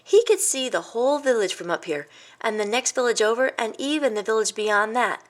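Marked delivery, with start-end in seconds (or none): none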